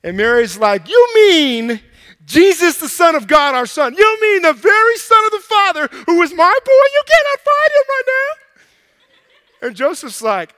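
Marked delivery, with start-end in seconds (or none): 0.9-9.7 high, loud indignant cry, slowing at the end; hammed-up outraged mother. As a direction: rise into a shrill scolding disbelief. Mary reacts to losing Jesus.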